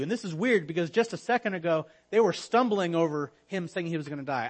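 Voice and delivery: normal talking voice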